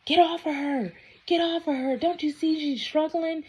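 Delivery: high, pleading woman's voice; girl pleading. Retold or imitated. Girlfriend pleads with the man to stop, high and anxious. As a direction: urge him off her in a high, anxious voice. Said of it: "His girl was like".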